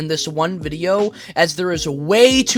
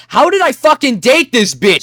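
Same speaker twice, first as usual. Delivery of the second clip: exasperated outburst; 8 semitones higher, 7 dB louder than usual. Real, not acted. Disbelief at ex-girlfriend's messages.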